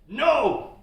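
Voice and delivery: a short hangry yell of refusal; retold yell. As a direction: snap it back like a hangry coder. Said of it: "Yell back at them, or way too loud".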